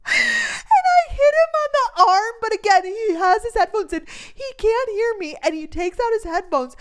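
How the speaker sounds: high, laughing storytelling; real laughter breaking into the words; laughing through it. Real, not acted. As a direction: speak through giggles at the memory. Laughing while telling story.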